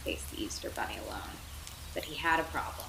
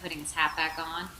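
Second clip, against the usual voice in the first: ordinary delivery, nothing marked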